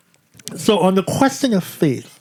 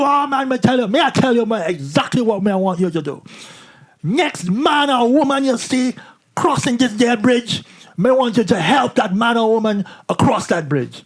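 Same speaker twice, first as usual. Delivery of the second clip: raised imitated Jamaican voice, slowing for the instruction; emphatic mock Jamaican guide; Jamaican accent; 6 semitones higher than usual. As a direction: spell out the charge in a put-on Jamaican accent. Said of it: "really thick Jamaican accent".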